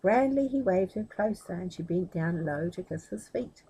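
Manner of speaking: usual speaking voice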